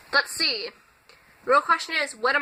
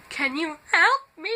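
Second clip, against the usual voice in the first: formal request that slides upward; voice slipping mid-request; 4 semitones higher than usual. Retold or imitated. Student asking a teacher for help, voice slipping higher. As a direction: imitate your voice cracking out of a formal tone. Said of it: "trying to do this formal thing".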